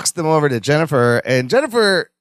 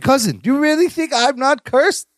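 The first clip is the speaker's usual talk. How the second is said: higher-pitched imitation of a hopeful question; incredulous question; 7 semitones higher than usual. Retelling a sitcom cousin asking about a curse, incredulous. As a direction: mimic an earnest, disbelieving question, pitched up. Quotes Balki pleading with his cousin.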